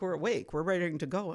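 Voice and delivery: usual speaking voice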